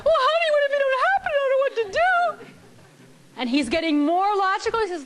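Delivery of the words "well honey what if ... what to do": high, fast, hammed-up panic; panicky wife. Wife frets to husband about lost trip money, in hammed-up high panic. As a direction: squeal it out like she's losing control. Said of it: "Out of control".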